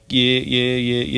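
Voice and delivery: usual speaking voice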